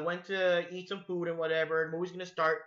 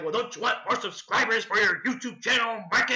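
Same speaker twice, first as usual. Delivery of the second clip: loud creepy clown sales pitch; Pennywise; 4 semitones higher, 5 dB louder than usual. Retold or imitated. Clown offers YouTube subscribers, loud creepy coaxing. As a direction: tempt him with a sinister clown voice. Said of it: "I'm Pennywise the Dancing Clown".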